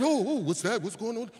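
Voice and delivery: a hint of a nosy helper; assistant's voice. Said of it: "he said".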